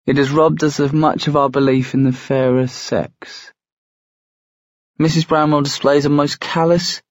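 high, measured letter-reading voice; letter read aloud. A narrator's reading. Sophie reading the brothers' letter out loud, high-pitched formal recital. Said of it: "read out loud".

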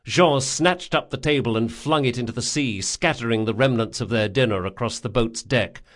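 usual speaking voice

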